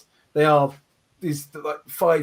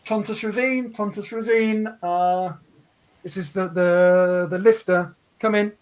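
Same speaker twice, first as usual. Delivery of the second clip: radio breaking up; 2 semitones higher than usual. Acted. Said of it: "old Patrick Park's voice coming through saying".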